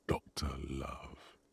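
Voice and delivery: slow breathy whisper. Acted. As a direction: hush the nickname slowly. Whispered punchline after "fixed by.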